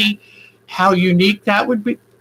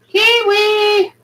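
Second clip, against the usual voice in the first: high call out; 12 semitones higher than usual. Real, not acted. Calling her bird Kiwi back, high and long.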